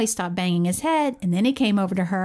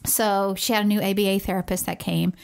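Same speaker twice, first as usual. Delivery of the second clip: ordinary talk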